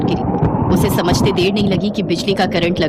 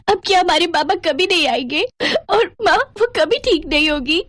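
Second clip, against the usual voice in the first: put-on high-pitched child voice, upset; tearful little girl; 9 semitones higher than usual. Little girl asking her sister about their parents, high and tearful. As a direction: plead like a small girl in tears. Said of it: "खूब रोई".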